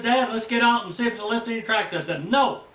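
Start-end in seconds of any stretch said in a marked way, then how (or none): 0.0-1.9 eager son. Retold or imitated. Son eager to look for tracks. 2.3-2.6 sharp no. Retold or imitated. Refusing son in fear.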